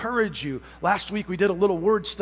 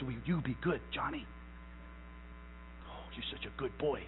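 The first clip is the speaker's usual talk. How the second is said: put-on much softer and lower, cooing to a child; mock sweet parent cooing; 8 semitones lower, 17 dB quieter than usual. Parent coos sweetly to little Johnny, softly. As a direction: coo it like a doting parent.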